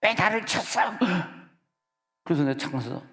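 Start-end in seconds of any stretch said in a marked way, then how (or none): 0.0-0.8 high, sharp angry shout at the driver; high-pitched mock yell of an angry man. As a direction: yell like a man who was bumped. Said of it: "막 뒤 소리 지르는 거예요".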